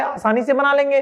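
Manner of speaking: usual speaking voice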